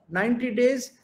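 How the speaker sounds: usual speaking voice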